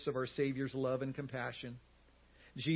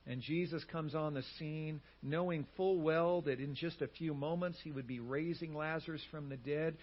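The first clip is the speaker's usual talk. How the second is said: ordinary delivery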